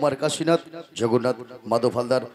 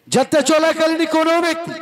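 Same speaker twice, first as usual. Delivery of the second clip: dramatic announcer call; 13 semitones higher, 5 dB louder than usual. Real, not acted. Jatra announcer over loudspeaker.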